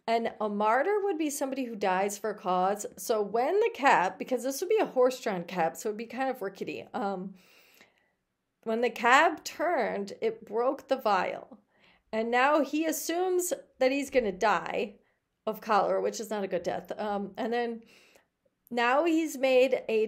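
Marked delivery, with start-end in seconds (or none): none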